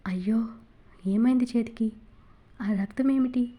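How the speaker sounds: ordinary delivery, nothing marked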